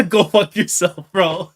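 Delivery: laughing curse. Real, not acted. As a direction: laugh it out at the villain, half broken. Cursing the villain character.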